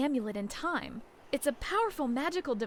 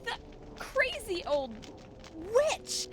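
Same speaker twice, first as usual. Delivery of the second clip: much higher, indignant cry at the witch; angry outburst; 8 semitones higher, slower than usual. Acted. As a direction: spit out the insult in outrage. Realizes witch tricked them.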